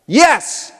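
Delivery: a sharp, high, loud YES; yelled yes. Shown as a demonstration. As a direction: shout it back like a crowd chant. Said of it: "you're gonna yell back".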